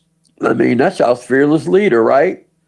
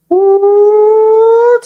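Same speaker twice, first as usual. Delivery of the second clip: high loud woo; a delighted shout up high; joyful woo; excited whoop; 6 dB louder than usual. Real, not acted. Whooping after laughing.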